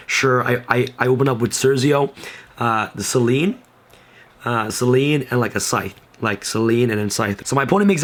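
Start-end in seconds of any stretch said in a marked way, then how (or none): none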